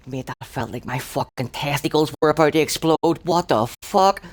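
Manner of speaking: high indignant rant at the surgeon; outraged complaint. Retold or imitated. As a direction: protest it still reeling from the pain. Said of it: "I said".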